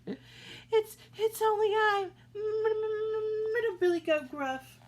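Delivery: high, stuttering frightened goat; terrified stammer. A narrator's reading. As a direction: stammer it out shaking with fear. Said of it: "He shook so hard".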